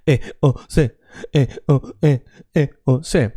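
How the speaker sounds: "eos" broken into separate, disconnected vowels; choppy foreigner style. Shown as a demonstration. Teacher mimicking an average foreigner, choppy and disconnected. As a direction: chop "eos" up sound by sound, stiffly. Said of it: "I don't want you to say".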